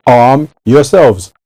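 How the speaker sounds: drawn out slowly, stressing yourselves; slow solemn command with the weight on yourselves; slow, weighty. Shown as a demonstration. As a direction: lean on every word, slow and grave.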